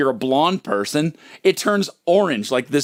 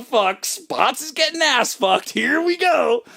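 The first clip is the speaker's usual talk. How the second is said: higher, slowed taunt; mock gleeful class; 9 semitones higher, slower than usual. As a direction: classmates relishing the trouble. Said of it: "the whole class is like".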